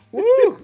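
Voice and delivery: high, loud woo; mock old-timer woo. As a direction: whoop it like a baffled old-timer. Mocks an old person's reaction.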